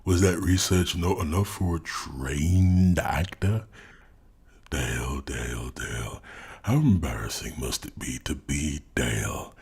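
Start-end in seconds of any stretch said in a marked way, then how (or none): 4.7-9.5 low, soft, disappointed sigh of a name; lowered, drawn-in mock sympathy; mock pity. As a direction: shake your head at him in hushed condescension. Mock pity addressed to Dale.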